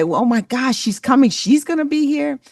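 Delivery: climbing high with delight as each phrase goes up; excited gushing. Retold or imitated. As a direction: sound like they can't wait for her to arrive. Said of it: "do they say".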